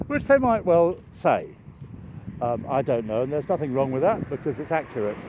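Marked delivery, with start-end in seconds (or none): none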